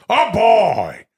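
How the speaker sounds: high, loud hammy holler; Robert De Niro doing Al Pacino. Acted. De Niro quoting his friend Al, loud mock Pacino holler. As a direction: belt out a drawn-out actor impression. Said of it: "my friend Al Pacino goes".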